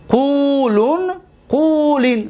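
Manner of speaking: قولن، قولین as qulun, qulin, ending on bare n; light emphatic nun. Shown as a demonstration. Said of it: "نون خفیفه".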